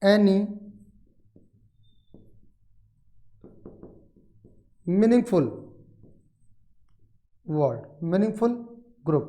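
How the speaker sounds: word by word with long pauses; dictation. Shown as a demonstration. Teacher has students write the syllable definition, slow dictation. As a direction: dictate slowly, leaving time to write each word. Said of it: "syllable के बारे में लिख लीजिएगा".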